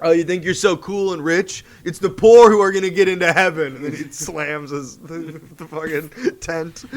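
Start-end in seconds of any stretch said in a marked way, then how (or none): 0.0-3.8 loud, mouthy mock retort; hammed-up defiant teenager talking back; cheeky preaching with attitude; sassy Jesus. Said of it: "being sassy".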